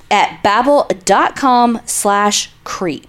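deliberate ad read of the web address; slow and clear. Shown as a demonstration. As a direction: spell out the URL slowly. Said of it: "One more time for the cheap seats".

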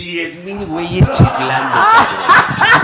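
slow words, high and loud through laughter; high, loud laughing finish. Real, not acted. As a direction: laugh it out in disbelief. Laughing about the whistling crowd.

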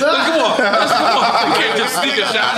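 laughing protest, loud and high; loud opinionated ribbing. Real, not acted. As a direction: come on, you can't do that.

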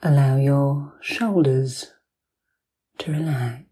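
ordinary talk, nothing marked

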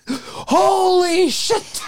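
slow, crying high "holy shit"; anguished high wail. Retold or imitated. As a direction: wail it out in horror. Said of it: "the Animal House horse".

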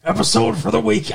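hammy crypt-keeper host, a bit low; bad Freddy impression. Host greeting viewers of a horror anthology, bad Freddy impression. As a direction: badly imitate a horror-show host. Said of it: "like a crypt keeper sort of thing".

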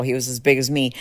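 ordinary talk, nothing marked

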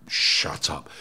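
low, breathy whispered dismissal; hushed shut up. Retold or imitated. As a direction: whisper it like a stunned officer. Officers reacting to page sixteen.

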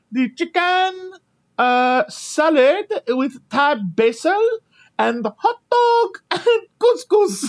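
very high, slow sing-song announcer; train announcer. Retold or imitated. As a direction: drag out the menu like the attendant. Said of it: "She's like".

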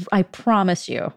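ordinary talk

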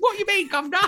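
very high, loud mock-British squawk; hammed-up British, pitched sky high; terrible British accent. Joking about blunt UK queens, terrible British accent. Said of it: "that's a terrible British accent".

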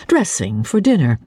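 usual speaking voice

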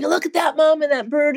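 put-on excited little boy. Son chattering at bird show.

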